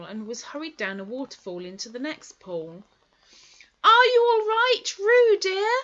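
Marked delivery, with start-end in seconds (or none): 3.8-5.7 high, loud, worried motherly call; a mother calling out to her little one; anxious call. Acted. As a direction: Kanga calling to Roo in the stream, anxious. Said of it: "called Kanga anxiously".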